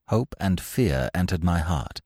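usual speaking voice

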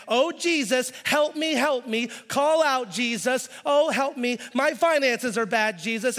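high, loud mock begging for help; hammed-up churchgoer pleading to Jesus over money; desperate plea. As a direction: whine it like someone crying out to Jesus in a panic.

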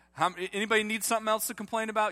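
playful tease. Real, not acted. Pastor teasing his congregation, quick and playful. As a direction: needle the crowd with a grin.

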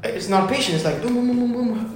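usual speaking voice

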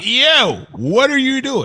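playing your own shocked self; incredulous reaction. Host retelling his reaction to the scene, high mock disbelief. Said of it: "I was like".

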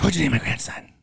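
whispered imitation of mom asking; hushed mom voice. Son retelling mom in hospital waiting room, whispered. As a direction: hush it like grandma leaning in.

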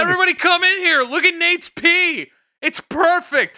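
high, hammed-up call to the whole house; mock excited calling. Calling everyone in to see perfect pee, hammed-up excitement. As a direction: shriek it out like a thrilled parent.

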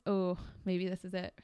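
ordinary talk

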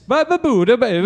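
high, loud gibberish Italian babble; imitating Italian speech; mock Italian gibberish. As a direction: babble like an old Italian woman. Mimicking old cousin's Italian.

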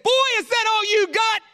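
high, loud mock-furious yell; mad challenger. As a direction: imitate an enraged boy roaring his taunt. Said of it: "now he was mad".

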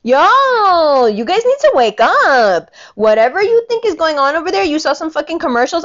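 high, loud angry rant. Real, not acted. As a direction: really lay into them. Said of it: "I told you I'm angry today".

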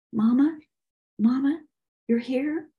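high, rising astonished questions; awed disbelief. Acted. Son thinks his dead mother has answered him, amazed. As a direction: voice lifts in wonder on each question.

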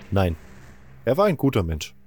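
usual speaking voice

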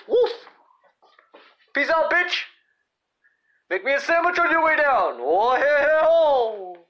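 high, loud yell after someone falling; mock shout. High loud yell at the wife he threw off the roof. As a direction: holler it down from the roof. Yelling as voter falls off roof.

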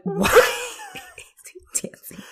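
high, slow, breathless whisper of disbelief; incredulous whisper. Retold or imitated. Watching the show, whispering in disbelief at the teacher dancing. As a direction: hush the question in shock, drawn out. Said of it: "I'm like".

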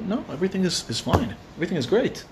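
softly voiced brush-off of concern; quiet reassurance. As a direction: reassure them softly, retelling his reply. Man reassures two strangers offering help.

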